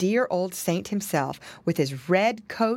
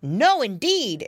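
slow, higher emphatic exclamation; exclaimed refusal; 5 semitones higher, 4 dB louder, slower than usual. A narrator's reading. Piggy twins answering mama together, exclaiming. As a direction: draw out a firm boyish refusal. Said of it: "exclaimed Curly Tail and Flop Ear".

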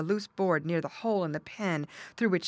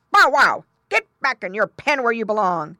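loud, higher-pitched dog talk; barking dog; 6 semitones higher, 12 dB louder than usual. A narrator's reading. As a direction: bark the order like a guard dog. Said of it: "the dog talking in his barking language".

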